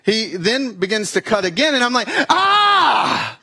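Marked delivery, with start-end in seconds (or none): 2.3-3.4 high loud yelp of pain; patient shrieking in pain. Retold or imitated. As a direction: scream as the blade goes in. Cut hurts during surgery.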